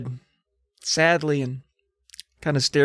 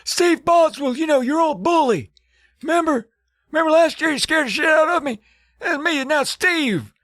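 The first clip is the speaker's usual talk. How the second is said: put-on high, strained old man voice. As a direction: croak it out like a frail old man. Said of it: "He's like".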